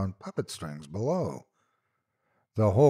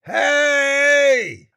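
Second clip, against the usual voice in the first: high, loud desperate yell; all-out cry; 11 dB louder than usual. A narrator's reading. Trapped man crying out to rescuers, all-out yell. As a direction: cry out with every bit of air. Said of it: "I cried with every mote of air".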